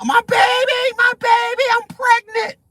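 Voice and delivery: high, whiny mock plea of a caught woman; mock pleading woman. Streamer mimics tackled shoplifter begging on the ground, high whiny mock plea. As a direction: squeak it out like a hammed-up pregnant shoplifter.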